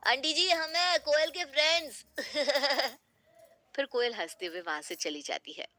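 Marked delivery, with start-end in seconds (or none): 0.1-2.0 a higher, distant group of friends' voice; cheerful friends chiming in over the phone; friends on call. Acted. Koyal's friends greet her mother over the video call, higher and far off. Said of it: "पीछे से दोस्तों की आवाज आती है". 2.2-2.9 friends laughing. Acted.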